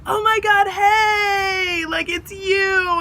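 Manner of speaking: high, loud squeal of recognition; high-pitched, drawn-out mock delight; excited greeting. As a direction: imitate drunk-happy friends shrieking hello. Friends greet her at door, retold.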